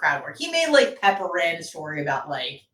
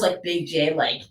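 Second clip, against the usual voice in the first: usual speaking voice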